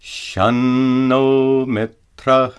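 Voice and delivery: slow chanted Shanno Mitra with dental na; Sanskrit example. Shown as a demonstration. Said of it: "Notice that the anuswara is pronounced as a dental na".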